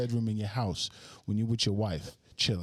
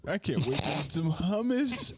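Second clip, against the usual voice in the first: teasing mimicry; 3 semitones higher, slower than usual. Host echoing a friend's hungry remark, in teasing mimicry. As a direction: draw out the line to mock your friend. Mocking friend's line just said.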